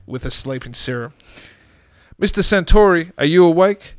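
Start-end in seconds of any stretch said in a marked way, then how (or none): 2.2-3.9 louder, higher voice over a speaker; crackly dashboard voice. Acted. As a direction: announce it like a crackling intercom. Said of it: "the voice crackled from the dashboard".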